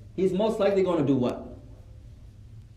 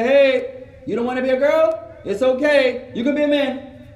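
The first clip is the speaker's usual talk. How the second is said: loud, high, emphatic coaxing; raised voice, mock-encouraging; mock persuader; 5 semitones higher, 6 dB louder than usual. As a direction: act out a pushy stranger calling to a child. Stranger persuading his child, acted.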